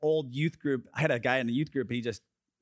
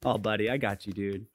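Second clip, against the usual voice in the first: tender mock embrace; 7 semitones lower than usual. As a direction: coo it gently, as if hugging a pal. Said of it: "took hold is not like this".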